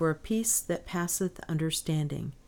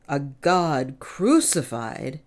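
slow, loud outcry; soul's outcry; 3 semitones lower, 5 dB louder, slower than usual. A narrator's reading. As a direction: cry it out slowly, word by word. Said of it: "his soul cried out".

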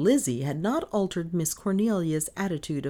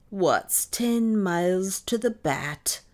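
slow, higher, doleful question; obdurate sigh; 6 semitones higher, 3 dB louder, slower than usual. A narrator's reading. Maid unmoved by mistress's map, sighing. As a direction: draw it out, unconvinced. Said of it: "the obdurate Lizzie sighed".

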